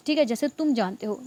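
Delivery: normal talking voice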